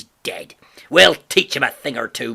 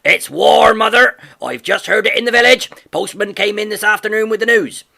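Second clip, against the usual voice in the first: much higher and louder, blustering; a gruff farmer crowing the news of war; father shouting to his wife across the yard; excited shout; 5 semitones higher, 9 dB louder than usual. A narrator's reading.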